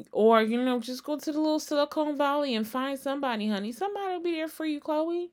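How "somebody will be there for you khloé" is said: quick reassurance. Real, not acted. Fast, high reassurance.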